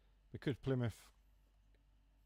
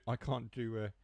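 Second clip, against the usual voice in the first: ordinary talk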